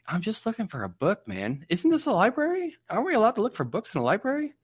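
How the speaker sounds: high, fast, whiny protest; squeaky indignant self-quote. Retold or imitated. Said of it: "I'm like".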